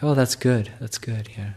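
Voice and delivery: retold approving reply. Nun answers a suffering student, lightly mimicked approval. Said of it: "her response was kind of like".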